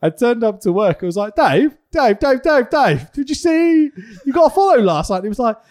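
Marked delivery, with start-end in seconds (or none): 1.3-5.2 loud, high repeated calling of Dave; eagerly calling across the office; excited calling. Retold or imitated.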